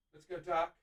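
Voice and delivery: ordinary delivery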